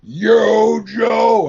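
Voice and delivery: high, loud, held-out shout; imitated battle cry. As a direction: belt it like a cartoon rallying cry.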